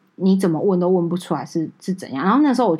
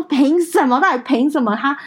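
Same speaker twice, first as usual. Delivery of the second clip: retold angry outcry; 5 semitones higher, 3 dB louder than usual. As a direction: hurl the question at the unfair god. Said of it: "然后我就哭出来". (激动地质问，声音拔高、放慢)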